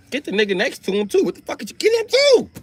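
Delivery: frantic squeal climbing very high; mock killing orders. Comedian as Medusa pointing out men to kill, hammed-up frenzy. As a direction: build to a high-pitched, hammed-up outburst.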